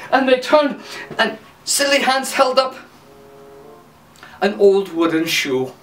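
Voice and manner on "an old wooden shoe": ordinary delivery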